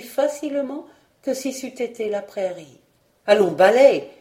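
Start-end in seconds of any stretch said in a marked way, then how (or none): 3.3-4.1 a loud barked order; shouted command. A narrator's reading. Aunt ordering the broom, shouting loudly. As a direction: call the broom to work at full voice. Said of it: "cria madame Colette".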